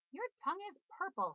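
high, loud excited scream. A narrator's reading. Girl screams at brother on the swing, high and loud. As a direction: shriek it with glee across the barn. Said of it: "screamed Fern".

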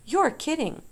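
high-pitched amazed disbelief; excited, can't-believe-it exclamation; incredulous. A narrator's reading. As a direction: blurt it out in astonishment. Wilbur cannot believe the number.

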